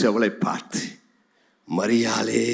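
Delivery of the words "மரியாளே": low, gentle call by name; tender calling. Acted. Jesus calling Mary by name, tenderly.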